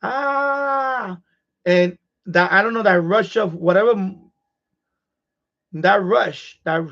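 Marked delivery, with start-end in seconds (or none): 0.0-1.2 long, high held "ah" scream; restrained, sustained high wail; mock scream. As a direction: imitate a drawn-out scream without full volume. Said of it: "I was screaming like".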